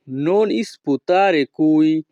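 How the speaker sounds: each Italian word spaced out very slowly; slow teaching model. Shown as a demonstration. Teacher models Italian phrase for students, very slow. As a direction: spell it out slowly for learners to copy. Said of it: "Italian ভাষা".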